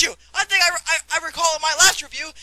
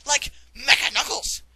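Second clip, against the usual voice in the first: usual speaking voice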